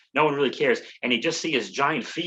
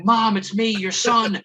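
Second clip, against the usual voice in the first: higher, louder mock plea to his mother; pleading son; 8 semitones higher, 4 dB louder, slower than usual. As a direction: urgently insist it's really you. Son begging mom to recognize him.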